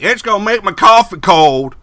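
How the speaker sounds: very high, loud complaining whine; grumpy old customer. Acted. Bert complaining about the wind, a high loud whine. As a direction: gripe like a cranky old man. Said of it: "Bert's like".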